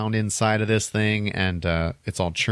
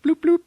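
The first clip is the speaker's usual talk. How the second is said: very high, louder bloop sounds; imitated bloop noise; 6 dB louder than usual. Imitating pills dropping from the machine, high bloops. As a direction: pop out each bloop like a dispenser.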